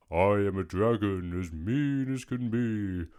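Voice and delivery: mean, roaring dragon voice; storybook dragon growling threats; scary dragon. A narrator's reading. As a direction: roar it out as a menacing dragon. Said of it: "a roar that was scary".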